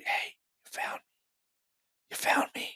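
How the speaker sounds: imitating a ghost in a slow whisper. Investigator replaying a recorded spirit voice, in an eerie whisper. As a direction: whisper it softly, drawn out, like a disembodied voice.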